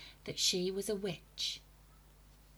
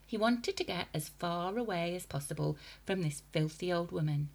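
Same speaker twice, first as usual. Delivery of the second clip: usual speaking voice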